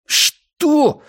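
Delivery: high-pitched, scandalised hiss; indignant hiss. A narrator's reading. Matthias blushing at Kaz's suggestion, indignant hiss. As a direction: hiss it in shocked embarrassment. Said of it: "прошипел Матиас, краснея до кончиков ушей".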